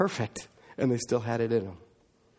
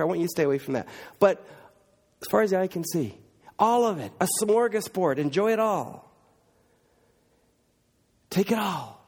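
God offering the whole garden, then one gentle warning; voicing God; 6 semitones higher than usual. Preacher voicing God in Eden.

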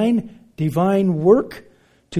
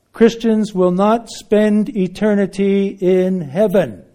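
loud ringing declaration to passing crowd; open-air proclamation; 3 semitones higher, 7 dB louder than usual. Retold or imitated. Preacher on the sketchboard, calling out loudly to passersby. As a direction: call it out to the street crowd. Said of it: "On the sketchboard".

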